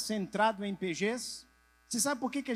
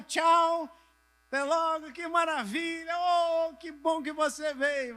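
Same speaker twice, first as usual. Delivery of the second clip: gushing higher-pitched goodbyes; drawn-out cheery church-door farewell; effusive farewell; 6 semitones higher than usual. Acted. Acting out goodbyes after church.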